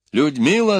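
loud, high stern address; calling out. A narrator's reading. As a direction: call the name with authority. Властный оклик перед вопросом. (громкий властный оклик)

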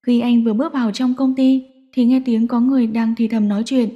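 usual speaking voice

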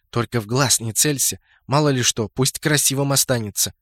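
ordinary delivery

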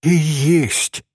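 usual speaking voice

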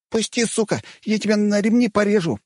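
put-on high shrieking boy threatening; squealing boy. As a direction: squeal like a cornered kid. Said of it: "верещал парнишка".

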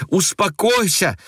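put-on frightened girl crying out to her aunt. A narrator's reading. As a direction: cry out to stop her. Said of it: "воскликнула ... испуганная Ася".

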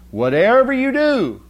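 high, loud yell of a mother's warning. Retold or imitated. Mother yells a warning at little Johnny, loud and high. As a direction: shout it up high like a fed-up mom. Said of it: "And Mommy says".

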